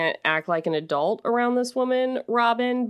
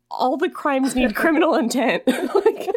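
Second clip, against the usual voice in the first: laughing; 8 semitones higher, 4 dB louder than usual. Real, not acted. Laughing at the show's name.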